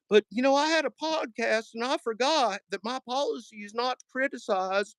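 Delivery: high mocking folksy voice throughout; Steve Johnson impression. Mocking a rival streamer's apology, high folksy voice. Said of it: "that was my Steve Johnson impression".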